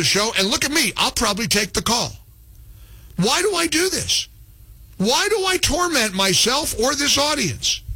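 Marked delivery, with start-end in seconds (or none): 3.2-7.8 high, exasperated complaining. Real, not acted. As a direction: whine it up high. Host exasperated at own choice.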